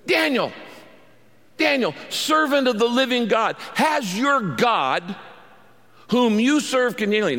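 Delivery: a king crying out in dread at the lions' pit; anguished calling out. Retold or imitated. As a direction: call out to him in anguish, afraid of the answer. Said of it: "in an anguished voice".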